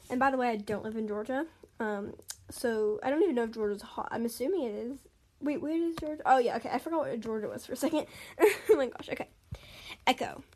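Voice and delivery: ordinary delivery, nothing marked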